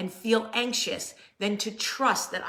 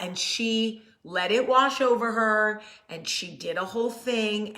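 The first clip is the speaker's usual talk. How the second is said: ordinary talk, nothing marked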